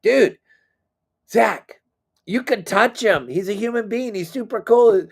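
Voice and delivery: dad egging on his son; coaxing. Retold or imitated. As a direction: nudge the starstruck kid forward. Dad urging awestruck son.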